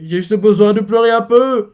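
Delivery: high, loud, hammed-up tearful whine; mock crying. Lilith bursting into tears at the table, hammed-up crying. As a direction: play it as a put-on cartoon sob. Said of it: "on la voit qui commence à pleurer".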